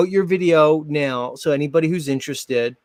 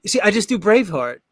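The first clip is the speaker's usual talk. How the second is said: ordinary talk, nothing marked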